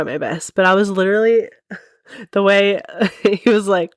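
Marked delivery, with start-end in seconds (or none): none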